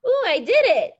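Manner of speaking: loud, high delighted cheer; excited. Real, not acted. Teacher finally gets the hard bottle note to sound, excited cheer. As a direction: celebrate the win out loud.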